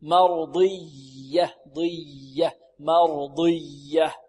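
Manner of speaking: مرضية with a slow, continuous yy; drawn-out soft ya in مرضية; soft flowing ya. Shown as a demonstration. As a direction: let the doubled ي of مرضية flow under pressure. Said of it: "رخوة".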